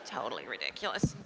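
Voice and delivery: ordinary talk, nothing marked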